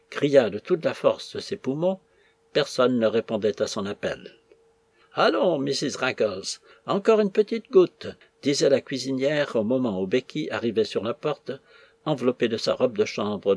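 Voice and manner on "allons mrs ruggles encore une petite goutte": lightly voiced cook, rising pitch; coaxing tipsy cook. A narrator's reading. Cook pressing liqueur on her companion, coaxing. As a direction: coax with a lilting lift at the end.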